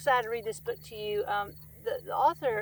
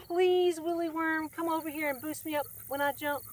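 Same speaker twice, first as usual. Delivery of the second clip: high, raised frog voice, coaxing; pleading little frog; 6 semitones higher than usual. Acted. Little frog asks Willy Worm for a boost, high and pleading. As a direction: beg sweetly for a boost.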